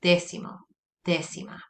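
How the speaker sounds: "Décimo, décima" each stressed on the first syllable; stress on accented E. Shown as a demonstration. Teacher shows where emphasis goes, "Décimo, décima" stressed on dé. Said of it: "That's where you put your emphasis".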